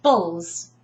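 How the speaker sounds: "Bulls" alone, the last chunk of vegetables; "Bulls" as a separate, firm teaching beat; isolated syllable. Shown as a demonstration. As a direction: model just "Bulls" for repeating. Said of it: "I break it down completely".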